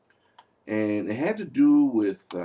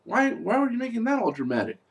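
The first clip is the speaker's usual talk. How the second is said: incredulous; 10 semitones higher than usual. Retold or imitated. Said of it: "I'm like".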